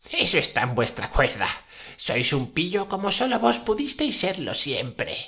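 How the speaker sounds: put-on high old witch voice. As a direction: pitch up into an old crone. Witch speaks, voiced by reader. (voz aguda de bruja vieja)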